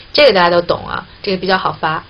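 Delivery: ordinary talk, nothing marked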